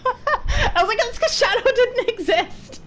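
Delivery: high-pitched giggling reply; laughing. Real, not acted. Telling a friend, laughing high. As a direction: laugh through the answer, voice up high.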